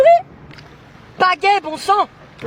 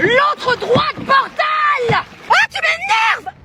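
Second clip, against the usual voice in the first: furious shout; 6 semitones higher, much slower than usual. Acted. Kayaker screaming at the partner steering wrong, furious shout. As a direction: yell it out, losing patience completely.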